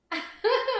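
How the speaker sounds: quick high-pitched giggle; short laugh. Real, not acted. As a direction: let out a brief high laugh. Laughs at own remark.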